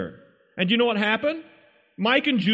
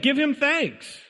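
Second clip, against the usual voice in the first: normal talking voice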